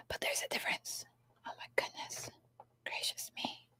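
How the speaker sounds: gasp, then whisper in mock wonder; whispered mock awe; awed whisper. Real, not acted. Noticing toy colour difference.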